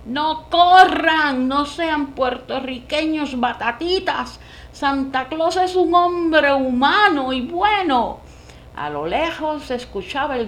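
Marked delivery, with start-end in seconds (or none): 0.0-8.2 desperate loud pleading shout; frantic shouting. Acted. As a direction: yell to stop the fleeing crowd. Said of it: "clamaba desaforadamente". (gritando desaforadamente)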